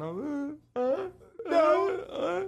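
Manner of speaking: put-on high, crying kid voice through sobs. Kid sobbing to the family in the car. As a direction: sob it out like a scared kid. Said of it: "I'm crying".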